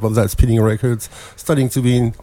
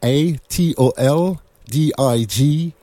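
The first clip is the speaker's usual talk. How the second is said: slow letter by letter spelling; slower than usual. Shown as a demonstration. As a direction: spell each letter out clearly. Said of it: "Now, now spell that for us".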